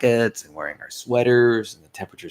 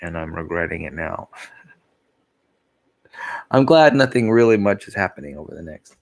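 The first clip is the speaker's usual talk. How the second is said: usual speaking voice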